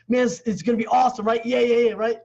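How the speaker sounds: high, loud, eager rush of words; excited retelling. Retold or imitated. Telling the coach about it, hyped and excited. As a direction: relive the thrill of a big goal. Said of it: "I'm all excited".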